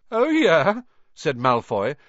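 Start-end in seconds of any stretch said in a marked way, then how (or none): 0.1-0.8 high, loud mocking retort; attempted sneer. A narrator's reading. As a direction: try to sneer back. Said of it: "trying to sneer".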